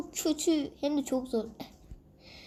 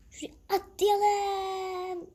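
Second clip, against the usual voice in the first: high, very slow wailing shout; crying shout; 7 semitones higher, much slower than usual. Real, not acted. Gamer leaping in a game, drawn-out high wail. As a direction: cry out as you leap.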